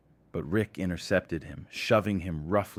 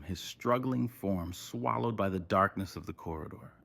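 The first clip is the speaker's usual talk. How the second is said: ordinary delivery, nothing marked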